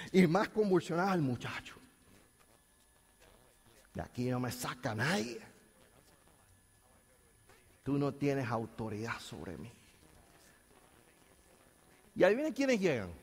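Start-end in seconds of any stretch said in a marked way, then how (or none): 3.9-9.7 low, softer menacing taunt; demon defiance. Retold or imitated. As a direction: sneer like a stubborn demon. Demonio desafía a los discípulos. (demonio grave, amenazante y burlón)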